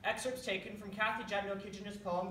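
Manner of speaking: ordinary delivery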